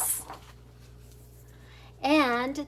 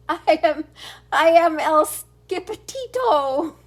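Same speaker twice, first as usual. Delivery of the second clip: boastful hero; 3 semitones higher, 6 dB louder than usual. Acted. Kitten boasting to the Chihuahua bandits, loud and proud. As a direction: puff up like a great swordsman.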